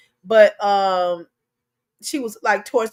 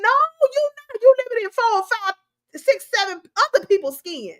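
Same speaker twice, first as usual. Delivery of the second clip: much higher, incredulous; exasperated retort; 11 semitones higher than usual. Real, not acted. Host rebutting the excuse, incredulous. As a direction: shoot it down in disbelief.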